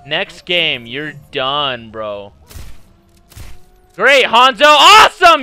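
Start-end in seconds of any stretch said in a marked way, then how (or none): 3.9-5.4 loud mock praise rising to a yell; bitterly sarcastic outburst; sarcastic rage. Real, not acted. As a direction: shout the praise with angry sarcasm.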